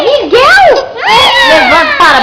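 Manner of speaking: calling out. Real, not acted. Calling out to baby.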